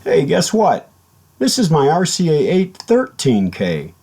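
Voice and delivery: ordinary talk, nothing marked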